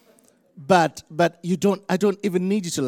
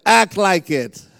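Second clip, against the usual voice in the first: slow, loud, raised command; preaching exhortation; 4 semitones higher, 7 dB louder, slower than usual. Shown as a demonstration. Preacher has congregation urge their neighbors, loud command. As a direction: punch each word out as a charge. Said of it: "Come on, say, tell him".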